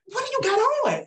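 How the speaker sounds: mock outraged husband, high and loud; husband's shocked high squeal. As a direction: squeal it in disbelief at her outfit. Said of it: "He was like".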